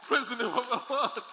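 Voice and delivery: loud, laughing call of praise; joyful laughing exclamation. Real, not acted. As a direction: praise the Lord through a laugh.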